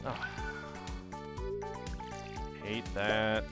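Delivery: ordinary talk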